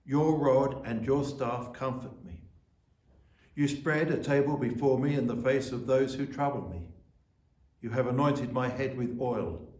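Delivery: measured, solemn reading of the psalm; scripture reading. Acted. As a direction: read it like a lesson in church. Reads Psalm 23 aloud.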